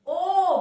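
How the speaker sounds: ओ held long and loud; round-lipped ओ. Shown as a demonstration. Teacher modelling for pupils to repeat, round-lipped ओ. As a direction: push ओ out through round lips. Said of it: "गोल गोल खुलेंगे".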